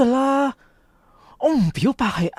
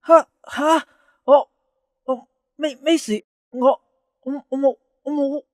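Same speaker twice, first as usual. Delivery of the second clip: flustered stammer; 4 semitones higher than usual. Acted. Caught out over the love letter.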